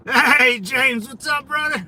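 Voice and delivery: loud friendly shout-out; hey brother, calling out from the car. Real, not acted. Greeting a passerby from car.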